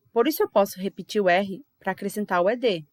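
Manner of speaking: usual speaking voice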